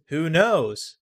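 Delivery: lightly put-on newscaster voice; TV reporter voice. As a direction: read it like a field reporter. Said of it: "the fake re-, T V reporter voice".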